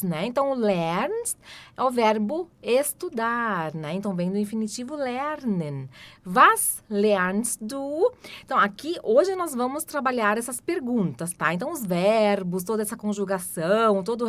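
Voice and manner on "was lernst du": "Was trinkt sie?" drawn out very slowly; very slow demo. Shown as a demonstration. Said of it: "teacher models question".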